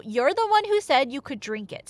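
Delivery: usual speaking voice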